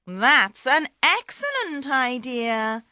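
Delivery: lightly voiced magic cat; Puss in Boots.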